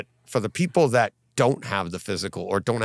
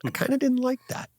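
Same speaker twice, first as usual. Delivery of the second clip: squeaky put-on voice, much higher, repeating the gripe; mock high voice. Host mocking his own gripe in a squeaky high voice. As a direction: pitch it way up like a whiny cartoon.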